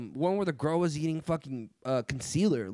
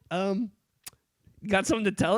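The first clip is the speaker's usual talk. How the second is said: mock drill sergeant; 6 semitones higher, 4 dB louder than usual. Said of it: "and they're like".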